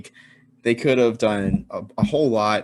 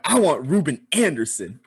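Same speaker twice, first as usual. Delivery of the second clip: raised, punchy emphatic demand; with gusto; 5 semitones higher than usual. Shown as a demonstration. Showing Jax how to say it, with gusto. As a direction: declare it with gusto, higher pitched. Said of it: "say it with like some gusto".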